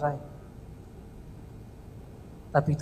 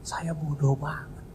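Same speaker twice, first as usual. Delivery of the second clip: whispered confession; 2 semitones lower, 6 dB quieter, slower than usual. Real, not acted. Confessing own foolishness.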